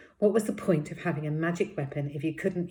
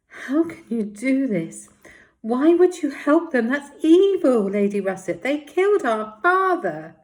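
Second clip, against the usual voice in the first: furious accusation; 9 semitones higher, 12 dB louder than usual. Acted. Paz accusing Lady Russet of betraying them, furious shout. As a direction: hurl the accusation at her, voice breaking with rage.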